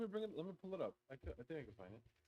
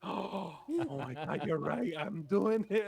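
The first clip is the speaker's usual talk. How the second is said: rising, louder startled admission; mock realization; 5 semitones higher, 7 dB louder, slower than usual. Woman retelling realizing she pushed her girlfriend away, startled and loud. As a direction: catch yourself and gasp it out.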